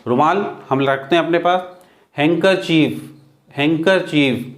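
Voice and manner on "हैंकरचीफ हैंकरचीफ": हैंकरचीफ as "hang-ker-chief", no d; silent D. Shown as a demonstration. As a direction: drop the d in हैंकरचीफ. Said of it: "डी यहाँ पे". (हैंकरचीफ में डी silent)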